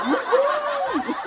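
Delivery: high joyful woo-hoo; joyful whoop. Pretend. Rolling into the pearly gates, high joyful whoop. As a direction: whoop as you roll in. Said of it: "rolling into the pearly gates like".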